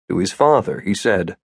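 usual speaking voice

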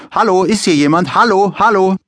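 high, loud repeated calling. A narrator's reading. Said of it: "ruft immerzu".

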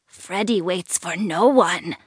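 put-on slow, eerie old witch croak; creepy witch voice. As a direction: drag it out like a creepy crone. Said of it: "creepy witch voice".

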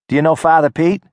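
put-on wary girl calling from a porch; tough city girl. Nadia voiced by narrator.

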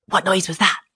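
a touch of alarm; startled thief, mildly voiced; frightened. A narrator's reading. Thief hears a strange voice in the dark, mildly frightened.

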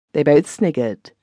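usual speaking voice

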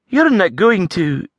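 high, hesitant lass voice; wary Scots lass. A narrator's reading. Avril eyeing him warily across the stool. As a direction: ask warily, half hoping.

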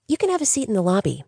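fast, high, bright receptionist voice; bubbly receptionist. Acted. Sandra showing Diana to the lobby, bubbly and quick. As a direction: rattle it off with a sunny smile. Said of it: "the bright, bubbly smile once again".

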